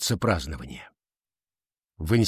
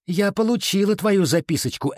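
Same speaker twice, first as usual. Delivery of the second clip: higher-pitched needling; haughty taunt; 9 semitones higher than usual. A narrator's reading. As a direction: taunt her about the note, lightly. Clare threatens Ella over note. (высокий, язвительный тон)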